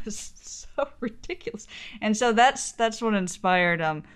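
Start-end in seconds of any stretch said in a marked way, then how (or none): 0.0-1.7 bursting into laughter mid-sentence; laughing. Real, not acted. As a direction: laugh as it cracks you up. Laughing at the ridiculous crawl.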